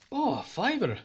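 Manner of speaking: a sudden happy lift on fiver; pleased surprise. Acted. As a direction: caught off guard by a generous tip. Worker handed a five-pound note.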